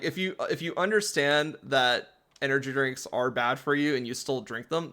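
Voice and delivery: ordinary talk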